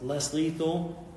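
slow, high, loud shouted call; drawn-out yell for the beanbag gun. Retold or imitated. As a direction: shout it across to your partner. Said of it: "I yelled".

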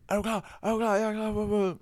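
high-pitched rapid-fire ready check; retold hype-up. Performer to guest just before the drop, hyped. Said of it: "og så var han sådan".

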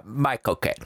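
lightly voiced Cockney my cocaine; Michael Caine impression. Shown as a demonstration. Performing on request for co-host, light Caine accent. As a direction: toss off a quick Caine my cocaine. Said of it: "Okay, give it to me".

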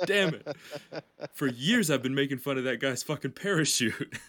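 high, loud, half-laughing cry; laughing regret. Retold or imitated. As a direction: laugh through the dismay. Steve regrets mocking parachute.

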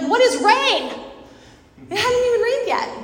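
high, loud, jeering disbelief; mocking scoff. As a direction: jeer at him like a scoffing crowd. Unbelievers mocking Noah.

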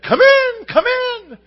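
a parrot calling out its welcome; high loud parrot come in. Acted. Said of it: "And the parrot said".

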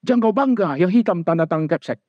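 high, fed-up mock mother listing what she gave; mother scolding her child over bad grades, high and whiny; ranting mother. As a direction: complain like an exasperated mom.

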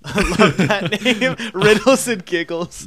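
giggling through the words; laughing while you say it. Real, not acted. Laughing at show name.

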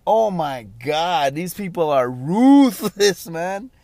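loud, high, drawn-out disbelief; high, stunned outburst; shocked reaction. Retold or imitated. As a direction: act out being floored, stretching the words. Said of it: "I was just like".

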